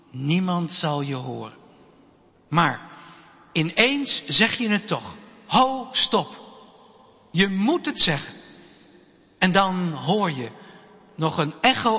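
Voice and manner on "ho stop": raised, higher call to stop; a child bravely shouting stop; firm call to stop. Acted. Child finally speaks up in fight. (kind roept luid en hoog: stop)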